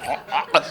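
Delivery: quiet hammed-up retching; soft mock gagging. Girl gagging quietly before touching anything. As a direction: gag softly. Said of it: "she's going".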